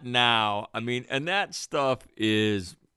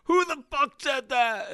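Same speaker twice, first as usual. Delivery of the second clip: much higher, yelled sergeant challenge; mock drill instructor yell; 14 semitones higher than usual. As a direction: bark it like a drill instructor bursting in. Drill instructor yelling in barracks.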